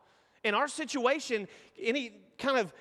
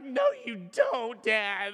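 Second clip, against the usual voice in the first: put-on hurt child; much slower than usual. Son refusing comfort.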